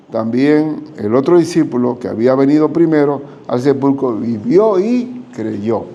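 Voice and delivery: ordinary delivery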